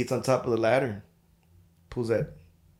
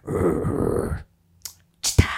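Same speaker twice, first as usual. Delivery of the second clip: low growl then a sudden loud yap; dog growl and yap; 7 semitones lower, 4 dB louder, much slower than usual. Retold or imitated. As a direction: growl first, then bark out chita.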